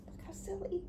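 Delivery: unvoiced take of Look how silly; whisper. Real, not acted. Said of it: "whispered take".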